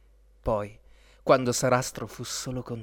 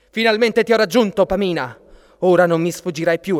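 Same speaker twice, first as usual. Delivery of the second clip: loud, raised-pitch villain's threat; menacing exclamation; 6 semitones higher, 11 dB louder than usual. A narrator's reading. Villain catches the fleeing princess, loud and menacing. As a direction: pounce with a loud, triumphant threat. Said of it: "apparve improvvisamente minaccioso".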